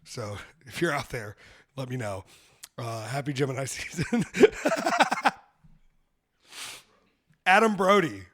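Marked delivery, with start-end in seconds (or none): 4.3-5.3 loud cackle. Real, not acted. Big laugh at own joke.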